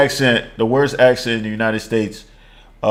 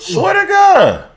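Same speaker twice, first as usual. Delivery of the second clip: emphatic; 4 dB louder, much slower than usual. Real, not acted. Emphatic disbelief.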